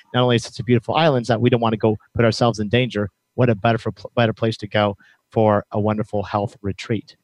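ordinary talk

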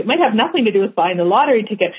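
usual speaking voice

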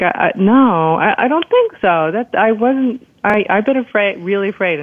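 retold protest. Patient telling the doctor it was not fear, protesting.

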